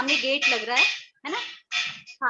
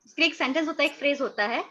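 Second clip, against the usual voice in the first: usual speaking voice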